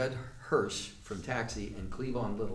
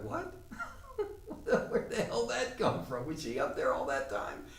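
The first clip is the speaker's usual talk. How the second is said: high-pitched disbelief breaking into laughter; incredulous laughing; 11 semitones higher than usual. Retold or imitated. Baffled playgoer reacting to the laughing audience, incredulous laughter. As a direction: laugh through the baffled questions.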